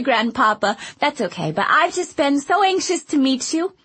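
put-on high, eager chatty girl; bubbly little girl rushing her words; young girl voice. A narrator's reading. Girl character voiced in story.

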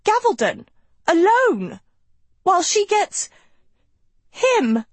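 high, loud shriek of outrage; horrified cry. Acted. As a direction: shriek it in horror. Sophie horrified outburst.

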